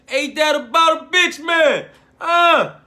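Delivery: loud, high exasperated yell; shouting in frustration, then settling; sudden shout. Real, not acted. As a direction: blow up at the situation. Outburst at having to pick.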